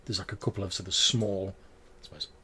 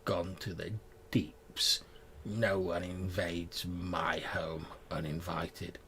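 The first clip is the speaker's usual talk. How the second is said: low, slow, softer menace; low haunting voice; 3 semitones lower, 7 dB quieter, slower than usual. Acted. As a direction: hidden witch warning intruders. GM voices hidden cave witch.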